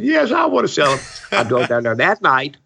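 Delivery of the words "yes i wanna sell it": put-on high, wailing old woman voice, much higher; imitating a crying old lady. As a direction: mimic her tearful panic after the robbery. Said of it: "she was crying".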